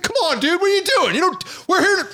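very high, squealed imitation of a complaint; mock little-girl yell. Startled haunted-house scarer scolding a prankster, imitated as girlish yelling. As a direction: whine it in an exaggerated falsetto. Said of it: "he fucking yelled at me like a, like a little girl".

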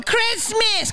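high mocking yell of the lady; heckler's shout. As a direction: mimic her calling out the word. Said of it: "she's going".

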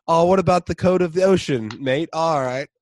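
put-on high, loud British rock star accent; Aldous Snow British accent. Aldous splashing Peter in the ocean, British accent. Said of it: "He's like".

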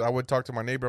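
ordinary talk, nothing marked

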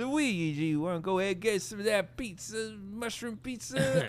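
high mock Italian accent; hammed-up Italian plumber accent; Italian Mario accent. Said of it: "going Italian with it".

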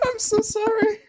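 very high, squeaky apology through laughter; real laughing fit, voice shooting up; helpless laughter. Real, not acted. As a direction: squeeze the words out while laughing.

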